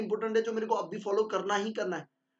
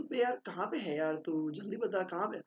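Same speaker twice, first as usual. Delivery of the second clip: softer, gentle asking; 6 dB quieter than usual. Shown as a demonstration. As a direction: ask kindly, no edge at all. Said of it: "soft tone में बोल रहे हो". (नरम, प्यार भरे लहजे में)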